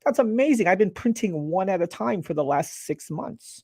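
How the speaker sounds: high-pitched mock astonishment; amazed. Imitating users who learn they can copy and paste, amazed. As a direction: gush like a user who just found a game-changing trick. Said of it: "people are like".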